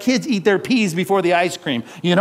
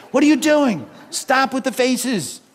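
scolding parent; 7 semitones higher than usual. Retold or imitated. Parent scolding kid, high and slow.

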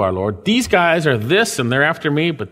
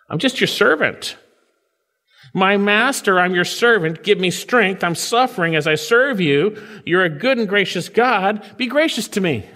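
raised, earnest humble prayer voice; humble servant's plea; 7 semitones higher than usual. Retold or imitated. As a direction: pray as a lowly servant to his master. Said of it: "he's humbling himself".

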